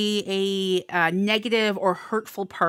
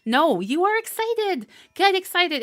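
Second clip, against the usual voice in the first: cheering on; 10 semitones higher, 3 dB louder than usual. Real, not acted. Reader cheers the Libra viewer on, high and rousing. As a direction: pump the listener up.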